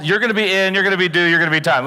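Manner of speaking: usual speaking voice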